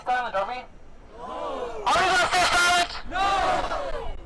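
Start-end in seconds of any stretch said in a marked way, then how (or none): 1.2-2.9 high, slow call to the crowd; rally shout. Acted. Rally speaker calling to crowd. 3.1-4.1 rally shout. Acted. Rally speaker answering own question.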